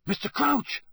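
put-on boy calling to a collapsed man; loud call. A narrator's reading. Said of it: "Harry said loudly".

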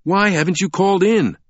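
high-pitched, indignant demand. Acted. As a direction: demand an explanation, voice way up. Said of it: "the man demanded".

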